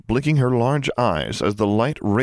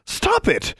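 very high, contained cry; panicked high-pitched protest; distressed cry. A narrator's reading. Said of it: "she cried".

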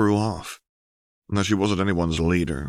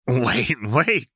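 higher, rising alarmed wait; nervous protest; 6 semitones higher, 4 dB louder than usual. A narrator's reading. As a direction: stop them in a flustered panic. Jinx panics at the votes.

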